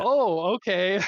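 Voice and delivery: high, loud incredulous "oh, okay"; mock surprise. Guild leader retelling his reply to an ally's snub, mock surprise.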